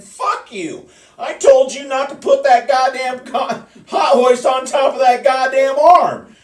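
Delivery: loud, high-pitched retold shouting; angry retort. Retold quarrel with the lineman.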